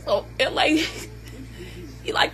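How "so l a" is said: normal talking voice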